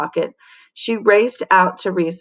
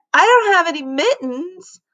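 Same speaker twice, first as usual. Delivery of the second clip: high complaining voice; spoiled girl complaining about no mittens; whiny girl; 11 semitones higher than usual. Acted. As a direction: whine it out. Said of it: "yelled Victoria".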